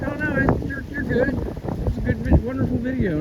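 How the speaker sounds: high, light mock reassurance; imitating reassuring viewers. Viewers reassuring him about his video, high mock voice. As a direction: chirp it back like kind commenters brushing it off. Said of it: "and everybody's like".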